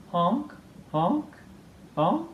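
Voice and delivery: lightly voiced goose honks, rising; a hint of a goose calling. Geese coming back to Bruce's house, light honking.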